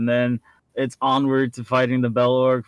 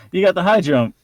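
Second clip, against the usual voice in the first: excited; 7 semitones higher, 5 dB louder than usual. Acted. Jokey exclamation.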